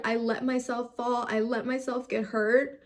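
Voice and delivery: ordinary talk, nothing marked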